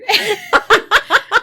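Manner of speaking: loud high laugh; shrieking giggle; high squealing laugh. Real, not acted. Laughing at own pronunciation.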